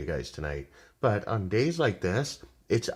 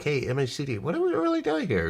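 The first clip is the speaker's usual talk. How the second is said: curious question, pitch jumping high at the end; voicing a trader; 7 semitones higher than usual. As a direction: ask the indicator playfully, rising high. Voicing trader asking indicator.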